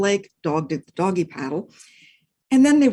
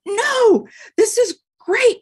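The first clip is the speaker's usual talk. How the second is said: high, louder, joyful; delighted man; 6 dB louder, slower than usual. Acted. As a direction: reassure the dog happily. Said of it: "Man said".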